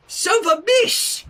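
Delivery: put-on high, loud toddler voice; insisting toddler. A narrator's reading. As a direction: repeat it stubbornly like a child. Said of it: "Flurry insisted".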